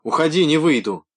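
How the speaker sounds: high, loud refusal; shouting back. A narrator's reading. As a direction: scream defiance through the door. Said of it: "и закричала".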